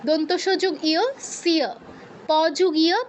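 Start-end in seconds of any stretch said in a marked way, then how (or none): 1.4-1.7 সিয় as siyo, with dental s; sounded y glide. Shown as a demonstration. Teacher demonstrating conjuncts, সিয় with audible y. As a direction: join s to a clear iyo in সিয়. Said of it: "ইয় থাকলে ইয় উচ্চারণ করতে হবে".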